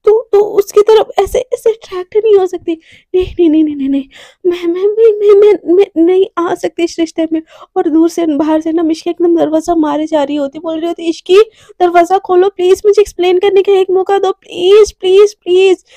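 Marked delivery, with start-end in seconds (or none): 3.2-6.8 panicked refusal. Acted. 11.0-15.8 pleading call. Acted. Pleading through the closed door.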